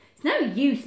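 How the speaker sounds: ordinary delivery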